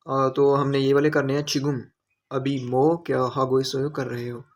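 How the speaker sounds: ordinary delivery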